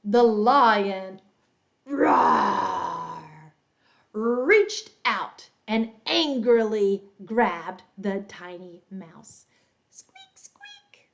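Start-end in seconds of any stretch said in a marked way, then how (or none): 1.9-3.5 big rough lion's roar. Pretend. Teacher shows children the story cue, big lion roar. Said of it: "when you hear lion". 9.9-11.1 tiny, high mouse squeaking; mouse squeaks. Pretend. Teacher shows children the mouse cue, tiny high squeaks. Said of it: "when you hear mouse".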